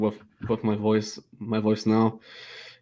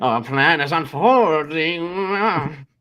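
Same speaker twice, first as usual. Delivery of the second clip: slow, high sinister gloat; evil villain voice; 5 semitones higher, much slower than usual. Pretend. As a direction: savor the evil plan. Villain banter.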